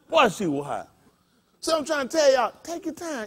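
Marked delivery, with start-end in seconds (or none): 2.6-3.3 soft, higher-pitched caution; hushed advice. Real, not acted. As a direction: urge them gently to hold back. Urging listeners to slow down.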